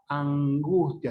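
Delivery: slowly stretched "angustia" with a velar ng; lingering back n before the g in "angustia"; velarized n. Shown as a demonstration. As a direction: draw out "angustia", tongue back off the tooth ridge. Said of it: "La lengua no llega a tocar en los alveolos".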